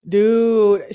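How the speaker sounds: emphatic dude. Real, not acted. Reacting in disbelief to the story.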